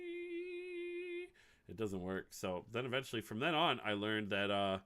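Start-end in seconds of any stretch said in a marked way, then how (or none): 0.0-1.3 high squeaky blank-mind sound; blank-out noise. Retold or imitated. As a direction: squeak out a dumb empty noise. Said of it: "your brain rate kinda just goes".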